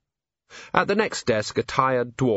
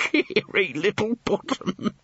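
slow, higher, through suppressed laughter; laughing; 9 semitones higher, slower than usual. A narrator's reading. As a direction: say the name while giggling. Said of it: "him laughing".

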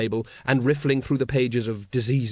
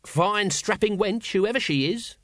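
high, slow appreciative remark; approving; 7 semitones higher than usual. A narrator's reading. Nobby admires the struggling woman, approvingly. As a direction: savour it with relish, drawn out. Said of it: "said Nobby approvingly".

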